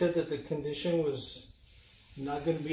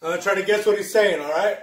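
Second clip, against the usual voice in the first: loud; 4 semitones higher, 8 dB louder, faster than usual. Real, not acted. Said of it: "Just a little bit".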